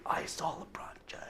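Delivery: hushed starstruck whisper; awed whisper. Acted. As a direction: breathe it out in disbelief. Fan whispering in awe.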